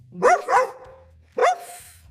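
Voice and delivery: high-pitched doggy woofs. Shown as a demonstration. Teacher showing children what a dog says, high woofs. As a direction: woof high like a little dog. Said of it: "A dog says".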